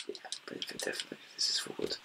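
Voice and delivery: fast whispered mutter to himself; whispered aside. Real, not acted. Gamer muttering to himself while sorting items, whispered. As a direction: hush it out quickly under the breath.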